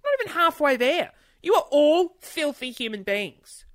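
loud, high, playful disgust; mock outrage. Real, not acted. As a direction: scold them in jest.

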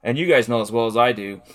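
usual speaking voice

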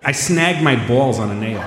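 slow, drawn-out mock Chicago accent. Shown as a demonstration. Example of how they all talk, slow Chicago accent. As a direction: stretch the words in a joke Chicago accent. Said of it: "they all talk like that".

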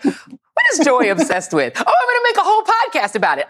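high-pitched, playful imagined inner monologue; mock eager musing. Host teasing her colleague about the podcast, mock eager musing.

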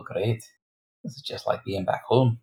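normal talking voice

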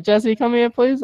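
high, polite motherly summons; mom calling. Retold or imitated. Retold mother summoning her child.

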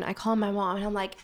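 ordinary talk

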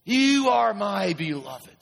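slow, high, emphatic declaration; God speaking firmly to his people. Acted. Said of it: "says God".

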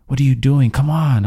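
irritated stranger yelling at the careless owner. Retold or imitated. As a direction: chew him out, exasperated. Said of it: "she starts to yell at me".

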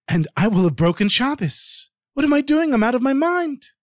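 fretting aloud in alarm; worried inner voice. A narrator's reading. Melamed's anxious inner debate.

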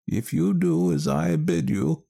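put-on high, slow wise old woman; a knowing village wise woman. Ellen Leah instructs.

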